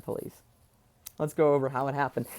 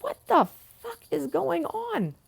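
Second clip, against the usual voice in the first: shocked; 12 semitones higher, 3 dB quieter than usual. Retold or imitated. Startled son reacting to chaos.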